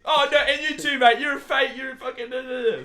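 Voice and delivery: high, loud ranting shout; angry mock Faye yell. Faye yelling at a mate she calls fake, loud and high. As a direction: keep screaming at everyone around. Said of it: "Faye is gonna be like".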